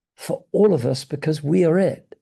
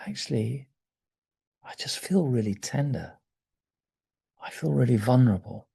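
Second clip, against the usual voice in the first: tender confession; 5 dB quieter than usual. Retold or imitated. Circle member admitting tenderness.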